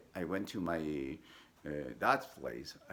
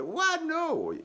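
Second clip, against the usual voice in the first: much higher and louder outraged what; a kid stunned he loses his summer; shocked cry; 7 dB louder than usual. Retold or imitated. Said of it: "I said".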